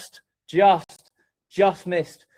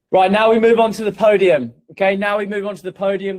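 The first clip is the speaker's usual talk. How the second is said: hyped announcement; 5 semitones higher, 7 dB louder than usual. Real, not acted. Hype announcement of podium.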